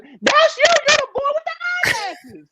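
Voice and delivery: excited fan shout. Retold or imitated. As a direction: scream it like a fan spotting a star. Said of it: "She was".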